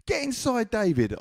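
very high-pitched calling grandma. Retold or imitated. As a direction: call the child in, in a high grandma voice. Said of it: "my grandma comes to me and she says".

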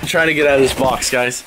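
ordinary talk

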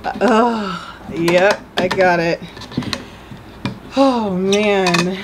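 panting from the effort; breathless after straining; out of breath. Real, not acted. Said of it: "sorry for all the grunting".

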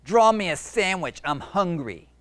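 loud, high bossy monster; demanding monster. Acted. Monster ordering Jeremy to draw food, bossy. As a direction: boss the kid around.

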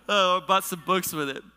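real, just out of a big laugh. Real, not acted. Pastor admitting he spent it on books, laughing.

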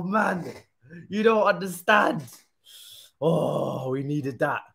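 normal talking voice

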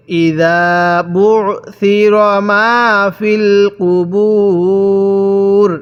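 very slow, with a long held u before the closing r; Quran recitation demo. Shown as a demonstration. Teacher modelling the verse ending, drawn-out recitation. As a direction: stretch the last word right out. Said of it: "এরকমভাবে শিখতে হবে".

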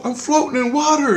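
very high, wondering voice; squeaky wonder. Retold or imitated. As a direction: marvel in a tiny high voice. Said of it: "and I go".

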